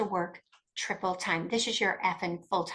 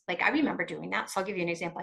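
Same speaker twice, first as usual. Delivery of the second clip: usual speaking voice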